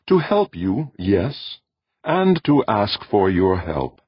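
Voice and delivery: high, measured voice of an alien being. Acted. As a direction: speak as a calm cosmic presence. Alien voice answers Jordan.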